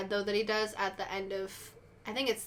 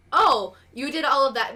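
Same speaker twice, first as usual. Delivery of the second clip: loud, higher-pitched "oh!" of discovery; sudden realisation; 4 semitones higher, 8 dB louder than usual. Acted. Viewer reacting to season two, sudden realisation. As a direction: act out the moment it dawns on you.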